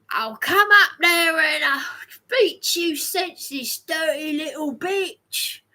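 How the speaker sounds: menacing threat; angry threat. Retold or imitated. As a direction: snarl it like a drunk parent. Voicing abusive parents' threat.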